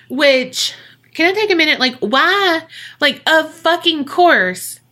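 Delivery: high-pitched, exasperated outburst; indignant. Real, not acted. Podcaster interrupting her own story to rant, indignant. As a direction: break off to rant in disbelief.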